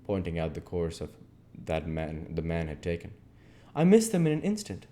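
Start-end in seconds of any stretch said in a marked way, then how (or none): 3.7-4.9 put-on high and loud frightened boy; Oliver's excited voice. A narrator's reading. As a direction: blurt it out breathlessly. Oliver answers Harry in chase.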